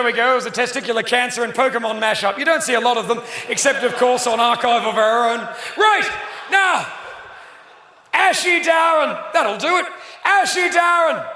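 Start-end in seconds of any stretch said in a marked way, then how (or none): none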